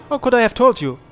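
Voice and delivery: usual speaking voice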